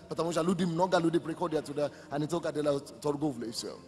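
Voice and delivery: ordinary talk, nothing marked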